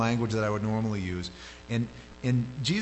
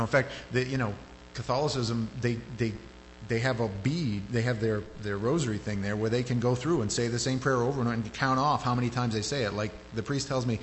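usual speaking voice

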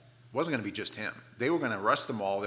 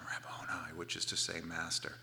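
low, very soft, near-whispered reply; hushed recognition; 6 semitones lower, 9 dB quieter than usual. Retold or imitated. Mary answering Jesus as she recognises his voice, hushed. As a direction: let it out quietly, almost whispering.

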